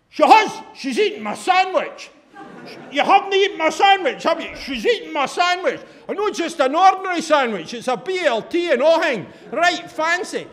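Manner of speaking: high, loud hammed-up Scottish woman, laughing through it; loud phone woman. Mimicking the phone woman entertaining the whole carriage, high and loud. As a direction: play the brash woman holding court on the train. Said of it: "she's going".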